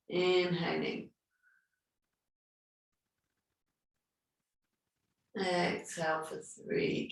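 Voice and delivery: calm, unhurried meditation guidance; guided breathing meditation. Acted. Yoga teacher guiding her online class through breathing, calm and soothing.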